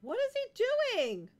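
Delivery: astonished. Real, not acted. Baffled by game scene.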